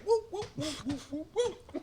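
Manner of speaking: high falsetto woo-hoo cheering; high whooping cheer. Real, not acted. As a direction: whoop it up high like a hyped crowd. Cheering after his own joke.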